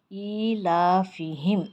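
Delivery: ایلافہم recited very slowly, vowels stretched long; drawn-out recitation. Shown as a demonstration. Teacher reciting a Quran word to students, slowly drawn out. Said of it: "اس طریقے سے".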